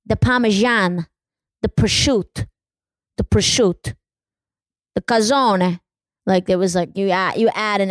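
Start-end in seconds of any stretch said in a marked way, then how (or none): 0.0-5.8 clipped Italian-American food words, endings dropped; mock Italian-American, each dish punched on its own; imitating Italian-American family. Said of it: "they would abbreviate everything".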